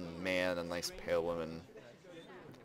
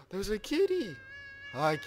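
gasp, then gush over a kitty; real excitement; 10 semitones higher than usual. Real, not acted. Player spots a kitty in the game, high thrilled gushing.

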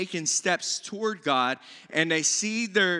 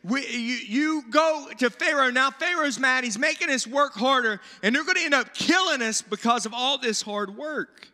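mock angry complaint; upset accusing; 7 semitones higher than usual. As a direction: throw the blame at Moses, a touch heated. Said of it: "they're upset, they're mad".

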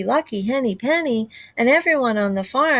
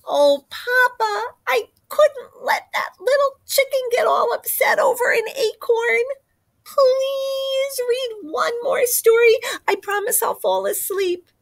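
put-on child voice; 10 semitones higher than usual. Chick pleading, crying out.

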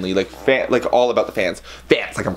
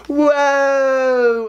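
high, sustained "whoa" sliding slightly down; drawn-out, high-pitched mock cry; long imitated whoa; 3 dB louder than usual. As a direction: hold a high cartoonish whoa. Imitates dropped phone going flying.

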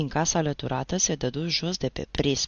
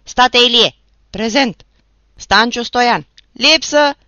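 loud, higher called names; roll-call shouts; 7 semitones higher, 14 dB louder, slower than usual. Acted. The teacher calls the roll, recruits shout back. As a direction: shout each name and reply.

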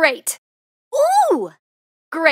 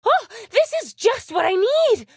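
high, gasping delight; 7 semitones higher than usual. Acted. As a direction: gush over just the right thing. Game character delighted by gift.

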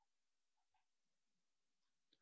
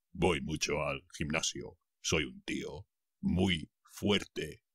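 Chad; 6 semitones lower, 7 dB quieter than usual. Acted. Spanish teacher quoting a gym-goer on his strength, low macho voice. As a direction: drop the voice and boast like a strong man. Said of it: "dijo Chad".

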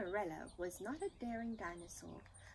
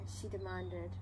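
ordinary delivery, nothing marked

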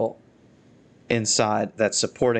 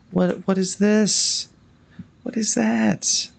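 higher, slow, incredulous questions; baffled surprise; 10 semitones higher, slower than usual. Real, not acted. Critiquing the straight cabin logs, slow baffled disbelief. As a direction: stare at something odd in disbelief.

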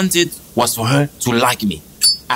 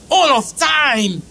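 exasperated cry; 12 semitones higher, slower than usual. Acted. Exasperated outburst over number.

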